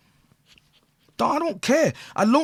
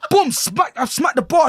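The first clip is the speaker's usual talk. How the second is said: ordinary talk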